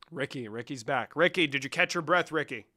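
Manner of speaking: ordinary delivery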